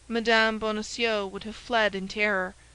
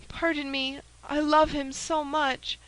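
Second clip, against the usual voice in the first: tender confession; 5 semitones higher than usual. Acted. Novice confessing her love.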